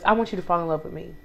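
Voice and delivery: quick, soft, lightly voiced divine voice; God's voice. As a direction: murmur it fast and soft, as God. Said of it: "all he kept saying to me was".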